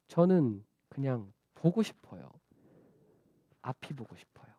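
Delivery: low, hushed confession of longing; quiet pleading. Acted. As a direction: let it sink to a near whisper. Blind man voicing his deepest wish.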